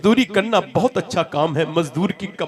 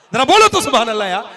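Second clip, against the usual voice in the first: louder urging call; 6 semitones higher, 9 dB louder, faster than usual. Real, not acted. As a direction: call out to the crowd, louder and higher.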